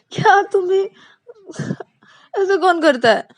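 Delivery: high, loud mock outrage; indignant complaint. Payal scolding Ananya for tickling her, indignant. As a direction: protest half-laughing.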